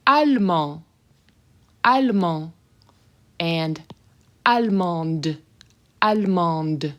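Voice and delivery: allemand with nasal end, allemande with a sounded final D; French pronunciation. Shown as a demonstration. Teacher contrasting masculine and feminine, careful French. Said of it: "you can hear the difference".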